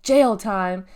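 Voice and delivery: loud, punchy threat; yelling. A narrator's reading. Cashier threatens the kids with jail, yelling. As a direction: bellow the threat at them. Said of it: "Norma was yelling now".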